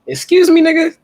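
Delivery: high, loud incredulous challenge; scandalised, high-pitched double take. Real, not acted. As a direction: pitch it way up in disbelief. Mock shock at odd term.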